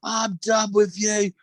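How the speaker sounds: mock wail, high and drawn out; crying child. Son whose crisps were thrown away, mock crying. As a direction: imitate a child's slow, high-pitched tearful outburst. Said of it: "He obviously started crying".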